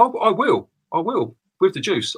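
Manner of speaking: usual speaking voice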